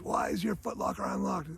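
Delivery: half-voiced retold demand; mock interrogation. Sergeant questions recruit about his locker, mock angry demand.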